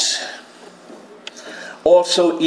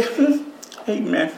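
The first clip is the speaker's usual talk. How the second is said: quiet, offhand hmm amen; mock half-hearted reply; limp amen; 2 semitones higher, 5 dB quieter than usual. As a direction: shrug it off with a soft amen. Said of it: "He didn't say".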